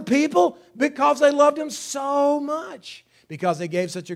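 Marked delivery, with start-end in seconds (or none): 1.7-2.4 "so" drawn out high; stress on SO. Shown as a demonstration. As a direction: lift and stretch the word "so". Repeats accented "so" demonstration.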